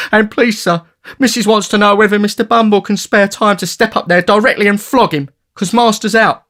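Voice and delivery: put-on high, tattling charity boy; Noah tattling. A narrator's reading. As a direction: tell tales in a high piping voice.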